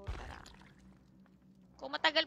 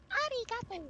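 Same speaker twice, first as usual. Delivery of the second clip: much higher, playful arigato; playful high-pitched thanks; 11 semitones higher than usual. Acted.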